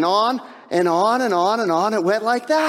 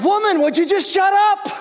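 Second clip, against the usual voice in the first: exasperated shout; 11 semitones higher than usual. Retold or imitated. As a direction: blow up at the nagging wife. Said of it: "he's like".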